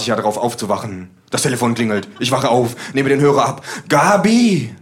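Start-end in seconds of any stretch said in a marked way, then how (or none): 3.9-4.7 loud, high eager call; eager shout. Acted. Narrator answering the phone, hoping for Gabi.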